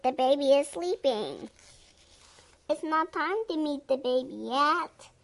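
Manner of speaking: usual speaking voice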